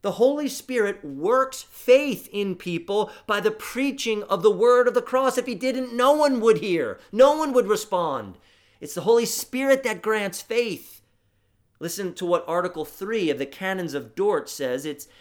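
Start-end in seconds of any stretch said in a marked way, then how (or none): 0.0-10.9 high, loud proclamation; preaching at full force. Acted. Preacher rousing his congregation, loud and high. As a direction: drive it home to the pews.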